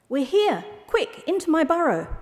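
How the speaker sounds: high-pitched, hurrying fox voice; urgent fox. A narrator's reading. Fox luring owl into burrow, high and urgent.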